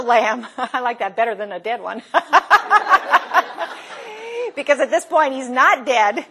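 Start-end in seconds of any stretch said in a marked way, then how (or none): 2.1-4.5 bursting into high, loud laughter; real laugh. Real, not acted. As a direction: let a real laugh break out. Teacher laughs at own joke.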